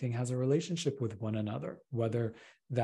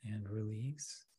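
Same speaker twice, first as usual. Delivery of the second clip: soft, intimate meditation voice, much softer; right in your ear; 5 dB quieter than usual. Acted. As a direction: close and hushed, easing them down.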